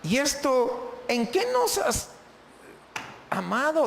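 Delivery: doubting questioner. Acted. Voicing people questioning God.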